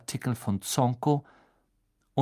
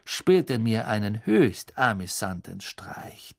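ordinary delivery

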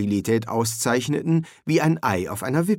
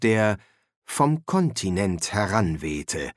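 ordinary delivery

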